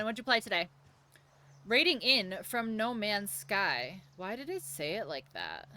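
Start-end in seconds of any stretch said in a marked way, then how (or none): none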